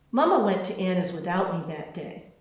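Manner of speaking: plain reading voice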